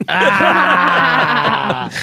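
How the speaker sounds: loud laughing cry; laughing shout. Real, not acted. Co-host reacting to the punchline with a loud laugh. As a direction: burst out laughing.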